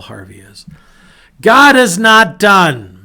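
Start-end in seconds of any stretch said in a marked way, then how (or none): 1.4-3.0 loud, high, slow proclamation; preaching climax. Real, not acted. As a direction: proclaim it to the back row.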